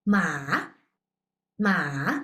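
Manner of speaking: Ma dipping low and turning up; ma falls low then rises; third tone. Shown as a demonstration. Teacher demonstrating third tone turn on ma. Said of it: "Actually, it has a turn".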